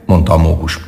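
ordinary delivery

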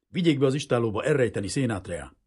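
loud, urgent instruction; urgent order. A narrator's reading. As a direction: order the men to hide him at once. Quick order as soldiers arrive.

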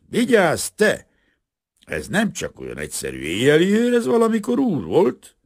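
high, loud call across the room; loud warning. A narrator's reading. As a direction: snap out a warning. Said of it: "hangosan odaszólt". (hangos, magas figyelmeztetés)

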